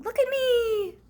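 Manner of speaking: a lightly voiced little monkey boasting; showing-off monkey. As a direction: show off for everyone. Monkey on the swing.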